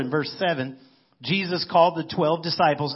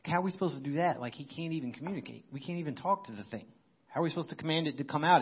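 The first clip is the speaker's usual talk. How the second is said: worried quiet mutter; 7 dB quieter, faster than usual. Retold or imitated. Pastor voices the worried disciples.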